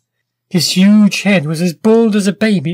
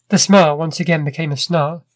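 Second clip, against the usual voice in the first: ordinary delivery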